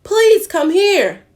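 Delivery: loud high calling; hollering. Acted. Animals hollering for Doddle, loud. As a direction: holler it across the farm. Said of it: "lined up and hollered".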